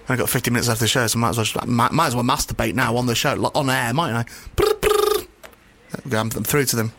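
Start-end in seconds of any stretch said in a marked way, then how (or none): 4.6-4.9 high trilled brr-brr like a ringing phone; phone ring imitation. Host fakes the sex line ringing, high trilled brr. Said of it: "just ringing".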